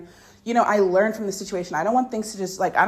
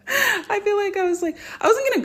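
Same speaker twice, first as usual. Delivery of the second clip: squeaky excited rush; high squeaky; 14 semitones higher, 4 dB louder than usual. Real, not acted. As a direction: jump way up into a squeal. Excited self-retelling, high squeal.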